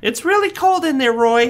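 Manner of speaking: put-on scared child warning.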